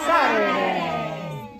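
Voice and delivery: mother proclaiming the refrain; triumphant Saturday. A narrator's reading. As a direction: hold out the last word big. Refrain shouted slowly.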